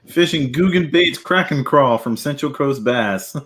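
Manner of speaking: reading a title. Acted. Reads video title aloud.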